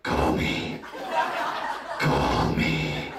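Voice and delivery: unvoiced breathy call me into voicemail; hammed-up whispered voicemail plea. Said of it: "drop you a little voicemail and go".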